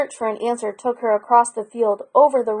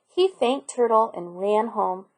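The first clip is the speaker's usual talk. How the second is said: plain reading voice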